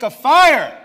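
sudden high shout; explosive scripture-reading exclamation; dramatic climax. A narrator's reading. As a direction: burst out loudly as the fire strikes.

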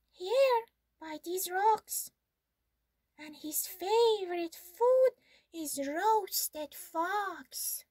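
high mouse voice, slowing on roasted fox; sly mouse. Acted.

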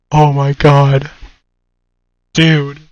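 loud, shaken exclamation; loud shocked. Real, not acted. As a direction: blurt it out after a scare. Reacting to scare.

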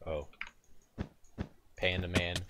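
normal talking voice

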